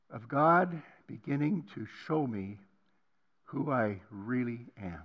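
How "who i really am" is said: ordinary delivery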